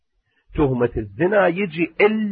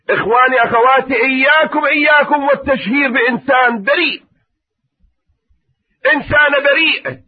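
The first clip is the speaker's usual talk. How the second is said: impassioned warning; 11 semitones higher, 5 dB louder than usual. Acted. Sermon warning to congregation.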